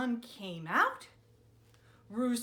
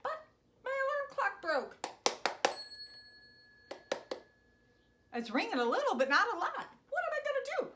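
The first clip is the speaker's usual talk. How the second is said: higher, fretting voice; worried Miss Sandy; 10 semitones higher, much faster than usual. Acted. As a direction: fuss over the broken clock. Miss Sandy worried about clock.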